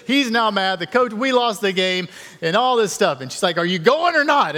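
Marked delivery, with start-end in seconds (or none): 3.6-4.6 high, loud pushy demand; mock insistent wife, pitched up and louder. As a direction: press him hard like an insistent wife. Wife pressing husband in story.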